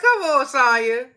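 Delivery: laughing disbelief at Sonya; scolding the TV, loud and amused; exasperated come-on. Retold or imitated. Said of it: "I was like".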